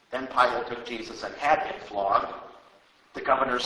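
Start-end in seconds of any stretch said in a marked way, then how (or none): none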